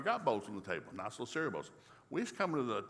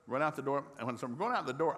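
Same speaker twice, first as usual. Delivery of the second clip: normal talking voice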